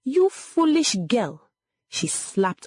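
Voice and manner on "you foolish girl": high, slow angry rebuke; restrained scream from an enraged mother; screamed scolding. A narrator's reading. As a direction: scold with pitch raised, words stretched. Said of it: "Egodi screamed".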